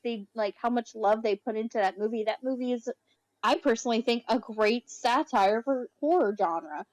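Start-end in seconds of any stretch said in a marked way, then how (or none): none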